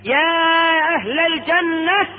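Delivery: high, drawn-out herald's call. Acted. Caller summoning the people of Paradise, calling out. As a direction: stretch the words as a crier does. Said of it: "إذ بمنادي ينادي".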